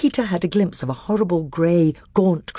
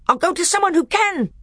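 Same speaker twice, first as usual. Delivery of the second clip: rising scream. Acted. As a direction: let it climb into a scream. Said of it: "whose voice now rose to a scream".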